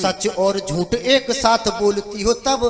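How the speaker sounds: measured, rhythmic stage poetry; verse recitation. Acted. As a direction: lay out the verse for the audience. Recites his comic verse. (लयदार कविता पाठ)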